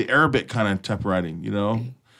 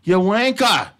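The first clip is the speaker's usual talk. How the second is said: mock British insult; 8 semitones higher, 5 dB louder, slower than usual. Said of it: "That's even my new thing".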